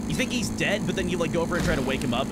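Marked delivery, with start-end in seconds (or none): none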